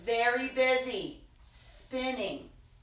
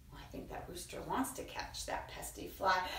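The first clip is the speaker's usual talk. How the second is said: ordinary delivery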